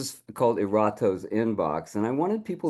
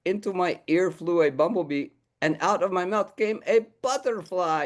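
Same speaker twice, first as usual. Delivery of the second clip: lightly raised puppet voice, reversed version; revised haiku; 7 semitones higher than usual. Acted. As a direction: say the improved line proudly. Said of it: "I think you should change that".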